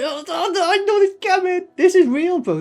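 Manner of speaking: high-pitched mock sob, hammed up; mock crying. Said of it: "he's crying as I finished that statement".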